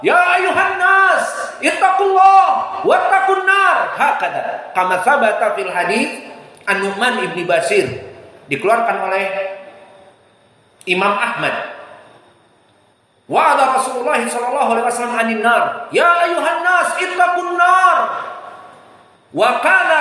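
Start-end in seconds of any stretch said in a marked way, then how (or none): 0.0-3.8 high, loud shout of "Ya ayuhan nas, ittaqullah"; the Prophet's warning cry; right way, shouted. Shown as a demonstration. 15.9-18.0 thundering sermon warning; Prophet's shouted warning. Retold or imitated. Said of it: "Nabi di masjid berteriak dalam khutbahnya".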